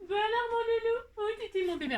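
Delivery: high cooing pet talk. Real, not acted. Baby talk to her pet.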